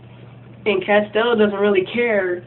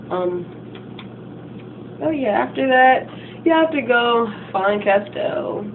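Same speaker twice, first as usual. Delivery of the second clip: usual speaking voice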